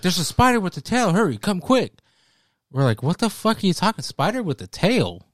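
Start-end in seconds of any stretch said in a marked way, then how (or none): 0.0-1.9 put-on higher, louder urgent call to the kids; excited call. Uncle calling kids urgently. 3.0-5.3 high, disbelieving question; can't believe what he's hearing; incredulous. Retold or imitated. Kids disbelieving the uncle.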